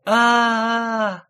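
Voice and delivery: high, loud frantic scream; wild scream. A narrator's reading. Woman on the side berth screaming at the knife. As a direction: let out a wild terrified scream. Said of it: "дико закричала женщина".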